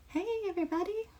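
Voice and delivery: very high, quick and light, chirping hello; squeaky greeting. Real, not acted. Host greeting viewers joining the stream in a squeaky high voice. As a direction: pop up high like a cartoon hello.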